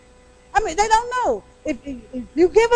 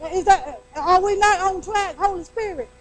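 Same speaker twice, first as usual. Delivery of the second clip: ordinary delivery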